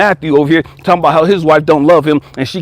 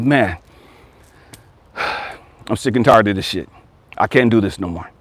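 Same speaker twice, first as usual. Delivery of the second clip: weary resignation; 8 semitones lower, 5 dB quieter than usual. Acted. Addict hitting rock bottom, quoted.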